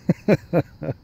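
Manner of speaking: a small real laugh; chuckle. Real, not acted. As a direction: chuckle at the picture. Laughing at own joke.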